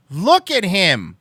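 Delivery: hammed-up outrage at him; mock outrage. Mock outraged about [name].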